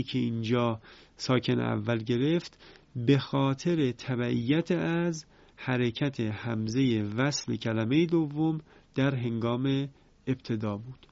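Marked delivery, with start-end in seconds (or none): none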